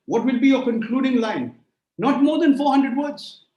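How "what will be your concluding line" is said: usual speaking voice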